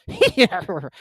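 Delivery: loud high snort; one sharp exclamation; hammed-up grunt; gryphon's hrjckrrh. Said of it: "an occasional exclamation of".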